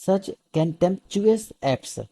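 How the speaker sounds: such contemptuous acts, each syllable drawn out very slowly; slow model reading. Shown as a demonstration. Teacher to students, modelling a hard word very slowly. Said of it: "اس کی جو ہے نا pronunciation وہ difficult ہے".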